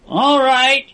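mild cry of exasperation; cried out. A narrator's reading. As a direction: push back at the captor. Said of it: "he cried".

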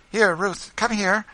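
high, loud summons; high-pitched, projected call. A narrator's reading. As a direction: call a friend over from another room. Rachel calls Ruth over.

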